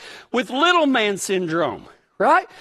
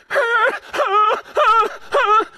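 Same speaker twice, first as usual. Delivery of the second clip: imitated dog whine; 12 semitones higher than usual. Dog whining while I walk off.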